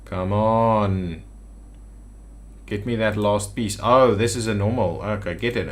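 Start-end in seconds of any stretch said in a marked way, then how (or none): none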